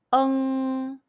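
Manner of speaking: "Ng" as an uh vowel sliding into a nasal ng; nasal final demo. Shown as a demonstration. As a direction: hold "Ng" long and level, ending in the nose. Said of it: "combine the e sound with the nasal".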